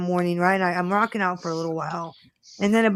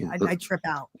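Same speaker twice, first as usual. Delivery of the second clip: normal talking voice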